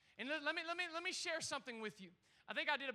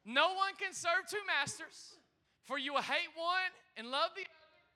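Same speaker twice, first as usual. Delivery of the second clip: preaching at full force; 4 semitones higher, 5 dB louder than usual. Acted. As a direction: call the verse out to the whole room. Preacher proclaims verse to congregation.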